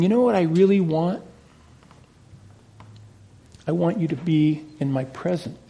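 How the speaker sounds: a preacher voicing God's quiet longing, softly; God speaking tenderly. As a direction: draw them close, almost hushed. God's wish voiced, preacher drops low.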